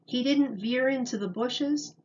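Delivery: ordinary delivery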